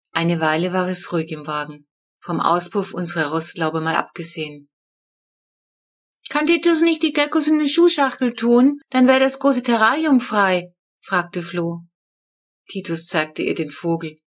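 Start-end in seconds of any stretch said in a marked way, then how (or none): 6.3-10.7 put-on high, bright-eyed small child asking; a small girl with a clever idea, voiced high; little girl voice. A narrator's reading. Little sister suggests emptying the terrarium, high child voice.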